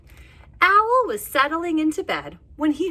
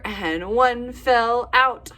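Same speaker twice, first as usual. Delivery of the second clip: ordinary delivery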